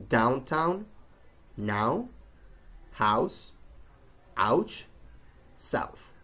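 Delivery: "Downtown, now, house, ouch, south" with an open A closing into W; OW diphthong. Shown as a demonstration. Teacher drilling the out sound, A-to-W glide in "Downtown, now, house, ouch, south". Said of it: "closing it with a W sound".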